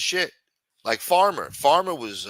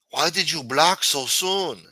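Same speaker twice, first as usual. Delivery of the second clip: higher, slow put-on voice; a slow, higher voice teasing the streamer; put-on chat voice; 5 semitones higher, 3 dB louder, slower than usual. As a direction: lightly voice a complaining chatter. Voicing a chat character.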